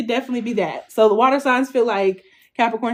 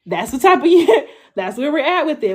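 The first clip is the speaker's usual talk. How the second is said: laughing; 6 semitones higher, 6 dB louder than usual. Real, not acted. Laughing at her own point.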